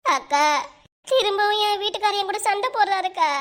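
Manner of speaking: high, whining mock sob; acted crying, pitched high; tearful complaint. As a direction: wail your troubles to an older friend. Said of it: "ஏன்டி அழுவுற?".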